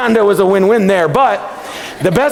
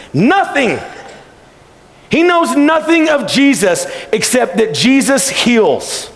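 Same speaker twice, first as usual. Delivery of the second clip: impassioned preaching; 5 semitones higher than usual. Acted. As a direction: preach it with rising fervour. Preaching climax.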